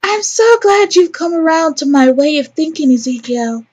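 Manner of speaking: high-pitched, gushing character voice; Lorraine. Acted. Lorraine glad Ezekiel agrees with her, high gushing character voice. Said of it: "here comes Lorraine".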